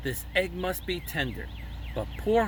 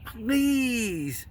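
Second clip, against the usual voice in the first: a high, pleading bird voice; begging; 8 semitones higher, 3 dB louder than usual. A narrator's reading. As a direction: beg him in a higher voice. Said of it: "begged the bird".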